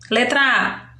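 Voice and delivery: ordinary talk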